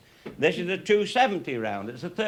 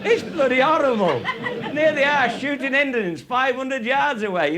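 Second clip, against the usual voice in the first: louder and higher, laughing as he talks; laughing through words; 7 semitones higher, 5 dB louder than usual. Real, not acted. Old shooter mocking cowboy films, laughing. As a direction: let the laugh carry every word. Mocks western film ballistics.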